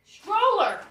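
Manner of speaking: loud, high exclamation of discovery; surprised realization. Real, not acted. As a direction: blurt it out as it dawns on you. Realizes box holds stroller.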